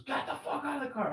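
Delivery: high, mocking yell of a tow guy; angry tow driver. Retelling the tow driver ordering him out, mock yell. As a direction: bark it like a pushy driver. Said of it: "And he goes".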